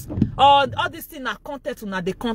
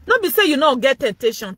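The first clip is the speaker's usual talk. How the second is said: ordinary delivery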